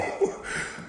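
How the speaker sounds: quiet laugh. Real, not acted. Laughing at her fall.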